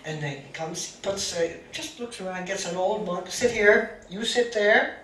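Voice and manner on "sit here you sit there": stern abbot orders. Retold or imitated. Abbot gives firm orders.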